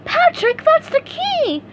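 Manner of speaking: very high, loud startled cry; surprised SpongeBob. A narrator's reading. SpongeBob spotting the key with Patrick, very high surprise. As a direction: shock at the key.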